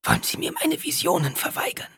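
high, raw whisper; hoarse whisper. A narrator's reading. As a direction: rasp it out defiantly. Alia hoarse, throat sore.

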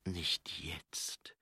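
whispered, with a long pause between phrases; inner thought. A narrator's reading. Angua dreading it in her thoughts, hushed. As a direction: whisper it to yourself, barely voiced. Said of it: "dachte sie".

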